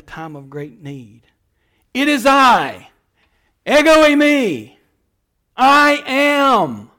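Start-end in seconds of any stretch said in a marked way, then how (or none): 1.9-6.9 louder, higher ringing declaration; solemn proclamation. Acted. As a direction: ring out the divine name. Preacher proclaims Jesus' words.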